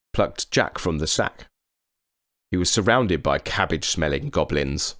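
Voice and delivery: ordinary delivery, nothing marked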